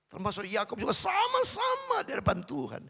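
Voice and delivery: high, loud rallying proclamation; forceful preaching. Real, not acted. Preacher assuring all are loved by God, forceful. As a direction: lift it high and loud for the congregation.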